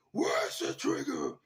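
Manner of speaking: high, strained raspy cry; Batman. Acted. Host doing his best Batman impression, cracking high and hoarse. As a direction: let the growl break upward into a hoarse yell. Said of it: "do my best Batman impression".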